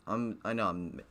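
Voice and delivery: usual speaking voice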